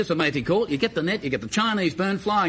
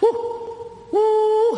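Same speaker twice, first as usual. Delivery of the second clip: high falsetto hooting woo calls; bunyip mating call; 14 semitones higher than usual. Retold or imitated. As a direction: hoot it like an animal calling in the bush. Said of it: "And I heard".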